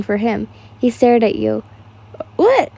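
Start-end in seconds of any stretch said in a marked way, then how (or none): 2.4-2.7 startled. Acted. Startled reaction in story.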